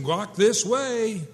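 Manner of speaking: very slow "Walk this way"; sign voice. Retold or imitated. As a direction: spell it out like a flashing sign. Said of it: "neon arrows saying".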